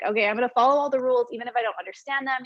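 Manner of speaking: ordinary delivery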